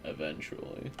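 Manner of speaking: under-the-breath repeat; whispered echo. Real, not acted. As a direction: whisper it to yourself, dry. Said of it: "it'll be free eventually".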